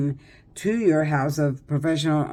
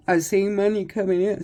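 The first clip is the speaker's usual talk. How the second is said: yawning; 4 semitones higher than usual. Real, not acted. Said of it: "Sorry, I'm yawning".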